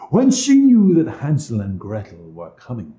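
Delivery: ordinary delivery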